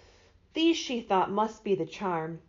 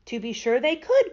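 higher, louder exclamation; excited cry; 4 semitones higher, 6 dB louder than usual. A narrator's reading. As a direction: cry out with sudden eagerness. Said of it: "cried the Scarecrow".

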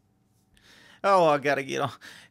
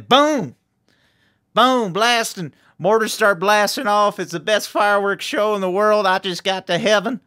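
booming fireworks excitement; thrilled, punchy blow-by-blow of a fireworks show; excited outburst; 5 semitones higher, 10 dB louder than usual. Acted. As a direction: burst out loud like mortars going off. Acts out fireworks show in heaven.

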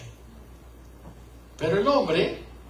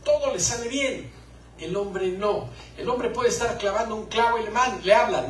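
usual speaking voice

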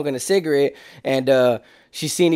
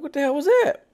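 high, hushed startled question; startled what; 10 semitones higher, 4 dB quieter than usual. Retold or imitated. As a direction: caught off guard, much higher, softer.

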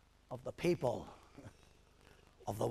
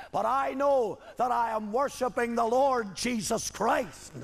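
loud, raised preacher's declaration; full-force preaching; 5 semitones higher, 4 dB louder than usual. Real, not acted. Preacher answering those who call his worship heresy, full-force preaching. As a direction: preach it out in rising bursts.

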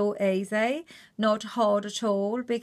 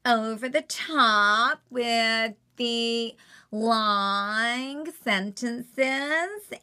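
very slow stretched-out words; slow American drawl; Kourtney drawl; much slower than usual. Shown as a demonstration. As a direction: drag out long sentences. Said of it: "So with her".

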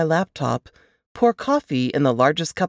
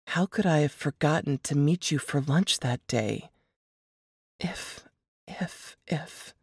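much softer, fading to a breath; faint guilty murmur; 8 dB quieter than usual. Acted. Narrator tormented by guilt, fading to a whisper. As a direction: let it die away to an unvoiced if. Guilty grief, words trailing to nothing.

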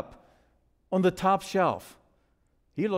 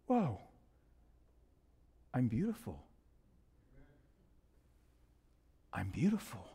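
very soft, low disbelief with long pauses; hushed wonder; 7 semitones lower, 10 dB quieter than usual. Acted. Teacup seeing itself in the mirror, awestruck. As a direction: let amazement sink almost to a murmur.

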